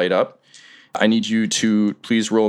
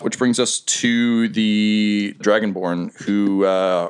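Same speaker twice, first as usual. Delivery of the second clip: ordinary delivery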